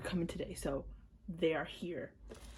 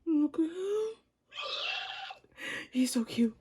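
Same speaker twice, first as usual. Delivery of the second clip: high gasp; 9 semitones higher than usual. Real, not acted. Fan sees his card, high gasp then gush. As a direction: gasp and gush over him.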